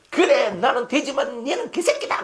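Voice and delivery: high-pitched mock-angry comeback; losing temper. Pastor acts someone firing back at a teaser, mock-angry. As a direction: snap back in hammed-up anger, laughter creeping in. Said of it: "성질이 나가지고".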